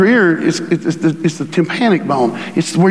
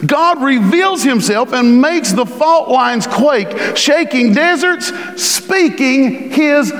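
high, slow scripture declamation; full-force preaching; 9 semitones higher, much slower than usual. Real, not acted. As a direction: stretch each phrase high and grand.

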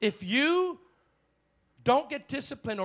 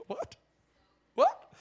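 mock surprise. Real, not acted. Surprised exclamation.

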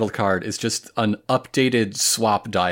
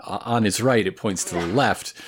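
usual speaking voice

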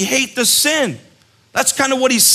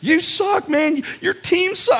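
put-on higher-pitched jeer at the kid; heated yell; 6 semitones higher, slower than usual. Camp villain yelling back at a heckling kid, high and heated. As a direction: yell back at him like a furious fan. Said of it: "I'm like".

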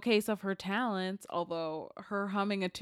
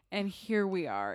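ordinary talk, nothing marked

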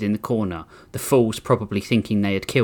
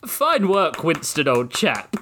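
put-on higher, slow polite British accent; polite British gentleman; 4 semitones higher, slower than usual. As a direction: clap him on with British courtesy. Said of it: "another politely clapped".

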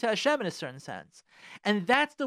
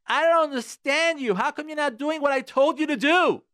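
raised, louder demanding husband's complaint; mock angry husband; 8 semitones higher, 6 dB louder than usual. Husband scolding his wife for not obeying, raised and louder. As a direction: ham up a bossy husband scolding. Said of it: "you're saying to her".

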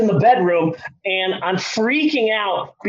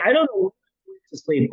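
ordinary talk

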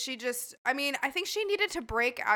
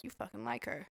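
much softer, under the voice; quiet aside; 12 dB quieter than usual. Retold or imitated. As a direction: say it privately, for one person's ears. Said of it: "like, quietly to me".

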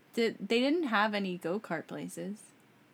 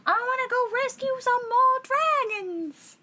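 high, louder cartoon dragon voice; eager little hero dragon, pitched way up; Spyro voice; 14 semitones higher, 5 dB louder than usual. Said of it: "That's how he talks".

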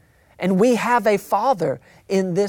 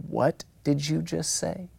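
eerily calm parent; 7 dB quieter than usual. Acted.

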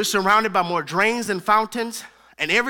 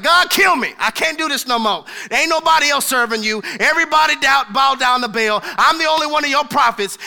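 higher, louder pleading prophet; higher, louder desperate plea; despairing Elijah; 5 semitones higher, 8 dB louder than usual. Retold or imitated. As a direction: cry out to God, worn out. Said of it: "He was like".

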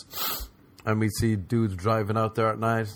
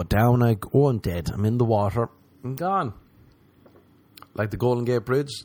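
ordinary talk

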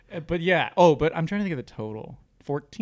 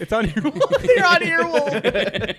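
high, slow laughing words; 11 semitones higher, 6 dB louder, slower than usual. Real, not acted. Joking the worker has a podcast, laughing.

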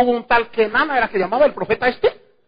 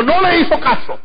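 usual speaking voice

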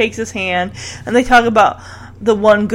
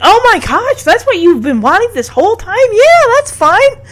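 high-pitched mock amazement; retold surprise; 12 semitones higher than usual. Host retells Edward's reaction to Evelyn's wish, surprised. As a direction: imitate someone pleasantly stunned, pitched way up. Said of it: "Edward is just so surprised".

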